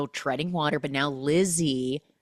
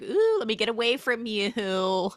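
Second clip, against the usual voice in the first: mock recoil; 7 semitones higher than usual. Meg recoiling from bleeding Cam.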